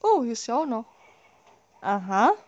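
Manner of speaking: usual speaking voice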